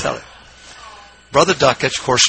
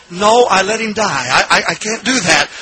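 higher-pitched mock anguish; retold anguish; 5 semitones higher, 3 dB louder than usual. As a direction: act out a tearful inner debate. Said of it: "he's, he's crying".

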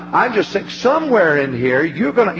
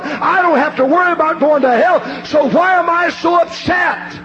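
building preaching; 5 semitones higher than usual. Acted. Preacher to congregation, rising from calm to shouted joy. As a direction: preach it up from calm to a full-throated cry.

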